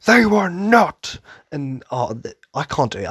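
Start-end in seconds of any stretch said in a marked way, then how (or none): 0.1-1.2 high, slow, loud rallying shout; mock heroic commander shout. Imitating Irvin yelling at his soldiers. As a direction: belt it like a commander rallying troops. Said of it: "he's like".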